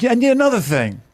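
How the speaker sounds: high, rapid mock quarrelling; mock argumentative. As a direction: imitate a partner firing back, fast and high. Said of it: "Becca will have an argument".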